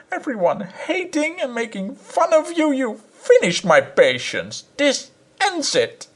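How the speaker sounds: a high, tearful scolding wife; furious wife. A narrator's reading. Wife to her husband, a high tearful tirade. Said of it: "in a furious temper".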